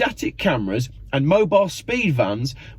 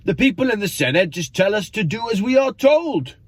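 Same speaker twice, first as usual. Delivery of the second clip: high, badly faked Welsh accent; terrible Welsh accent; 7 semitones higher than usual. Swansea carer complains about the Senate, terrible Welsh accent. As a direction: do a bad Welsh accent, pitched well up. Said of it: "Terrible Welsh accent there".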